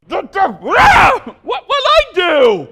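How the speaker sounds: high, loud and very slow, protesting; mock outraged innocence. Bystander hit by a passed-on rock, with mock outraged innocence. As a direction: whine out hammed-up innocence.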